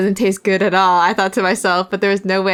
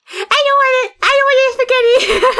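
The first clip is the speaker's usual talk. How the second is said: high, loud shouted refusal through a laugh; shrill refusal; 5 dB louder than usual. Acted. Refusing Yua's spaghetti, high laughing shout. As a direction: squeal it like a kid refusing dinner.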